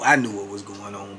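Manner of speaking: ordinary talk, nothing marked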